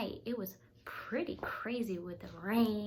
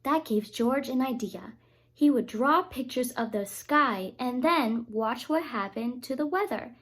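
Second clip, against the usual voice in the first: louder, lively storybook voice; reading to children; 2 semitones higher, 12 dB louder than usual. A narrator's reading. A reader reads Curious George aloud to children. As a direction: read it out bright for kids.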